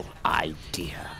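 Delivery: breathy whispered awful idea. A narrator's reading. Narrator hushes the Grinch's awful idea, whispered. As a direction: whisper it like a wicked secret.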